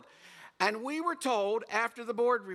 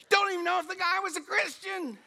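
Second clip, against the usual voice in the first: much higher, exasperated; incredulous; 11 semitones higher than usual. Real, not acted. Preacher joking to the congregation, incredulous. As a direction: toss it off in disbelief.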